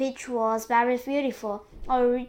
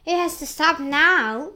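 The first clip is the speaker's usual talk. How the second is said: loud, higher yell; 5 semitones higher, 9 dB louder than usual. A narrator's reading. An aunt yells at the growing peach, yelled. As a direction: yell it out in excitement. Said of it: "and Spiker yelled".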